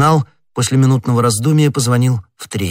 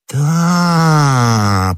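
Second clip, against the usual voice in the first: long drawn-out, higher, falling "yes"; lost in recollection; 5 semitones higher, 3 dB louder than usual. A narrator's reading. Prisoner thinking back on his wife's outings, drawn-out musing. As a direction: stretch it out as memories come back. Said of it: "углубляясь в воспоминания".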